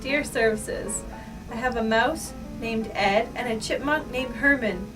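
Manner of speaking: ordinary talk, nothing marked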